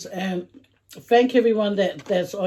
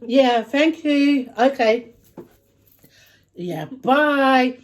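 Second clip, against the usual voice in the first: drawn-out, higher polite thanks and goodbye; sweet phone voice; 5 semitones higher, 5 dB louder, much slower than usual. Acted. Parent ending a phone call while kids misbehave, sweet put-on voice.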